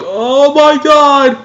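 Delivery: very high, slow, drawn-out oh my god; stunned gasp. Retold or imitated. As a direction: stretch it out in shock. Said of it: "And you're like".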